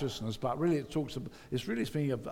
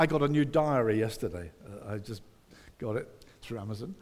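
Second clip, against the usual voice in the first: ordinary talk, nothing marked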